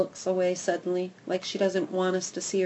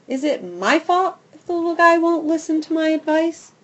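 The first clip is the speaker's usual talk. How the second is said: high, louder defensive dog voice; defensive Goldie; 9 semitones higher, 7 dB louder than usual. Acted. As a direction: shrug off the blame indignantly.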